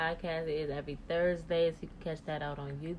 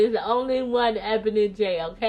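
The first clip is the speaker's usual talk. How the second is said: loud; 5 semitones higher, 11 dB louder than usual. Real, not acted. Proudly claims her name.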